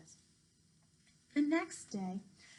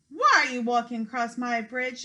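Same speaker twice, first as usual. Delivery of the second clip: loud yelling troll; 8 dB louder than usual. Acted. Troll yells at the goat on his bridge, loud. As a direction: bellow at the goat. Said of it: "yelled the troll".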